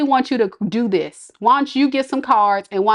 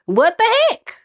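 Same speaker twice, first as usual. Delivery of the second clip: what the heck, pitched way up in disbelief; mock disbelief; 11 semitones higher than usual. Real, not acted. To her viewers about the guy who popped up, a high disbelieving squeal.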